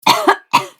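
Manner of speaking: sudden hard cough, choking; real coughing fit. Real, not acted.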